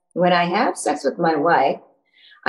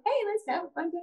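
sweet chirpy voice; 11 semitones higher, 10 dB quieter than usual. Retold or imitated. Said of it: "I'm in".

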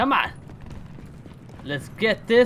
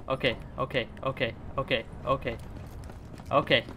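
tense muttering; 6 semitones lower, 9 dB quieter than usual. Real, not acted. Tense focus.

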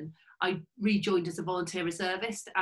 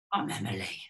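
put-on sullen teen; 12 semitones lower, 4 dB quieter than usual. Sullen teen cadet answers.

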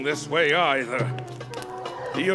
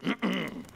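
soft, deliberate throat-clear; 4 semitones higher, 6 dB quieter than usual. Acted. King about to resume his tale, throat clearing. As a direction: clear your throat to take back the floor.

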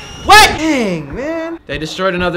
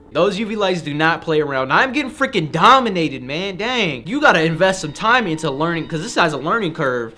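usual speaking voice

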